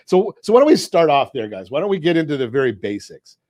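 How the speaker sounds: ordinary talk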